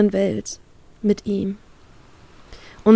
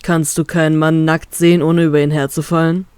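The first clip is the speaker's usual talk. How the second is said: teasing captain; 4 semitones lower than usual. Acted. Male captain voiced by narrator.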